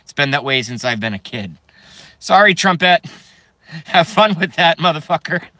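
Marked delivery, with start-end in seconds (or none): 2.2-5.6 laughing. Real, not acted. Laughing apology to viewer.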